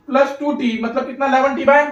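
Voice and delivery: normal talking voice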